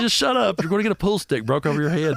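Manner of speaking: high-pitched frantic scolding; exasperated warning. Retold or imitated. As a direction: plead with a bandmate to shut up.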